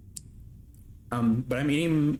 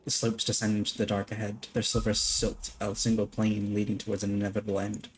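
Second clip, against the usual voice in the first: soft, steady narration of the lore; quiet lore-reading voice; reading game text; 4 dB quieter than usual. Acted. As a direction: read it like a hushed story narrator. Reads game text aloud.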